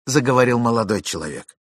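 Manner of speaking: ordinary delivery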